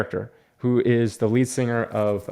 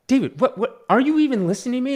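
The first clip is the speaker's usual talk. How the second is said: put-on much higher, exasperated girlfriend voice; mock girlfriend; 12 semitones higher than usual. Girlfriend scolding him for not listening, high mock voice. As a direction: mimic her annoyance at being ignored. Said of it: "And she's like".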